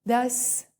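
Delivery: ordinary talk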